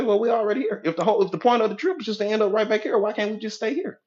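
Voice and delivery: high, quick protesting husband; unexcited husband. Acted. Husband objecting to leaving for vacation, high and reluctant. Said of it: "your husband is not excited".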